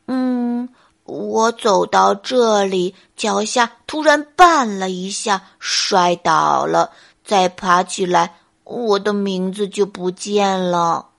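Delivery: low, slow, lumbering bear voice; pondering clumsy bear. Acted. Bear recounts his fall to deer, ponderously. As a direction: think back slowly, word by word.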